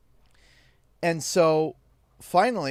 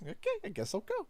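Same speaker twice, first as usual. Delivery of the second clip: meek reply; 3 semitones higher, 11 dB quieter, faster than usual. Retold or imitated. Mock meek compliance.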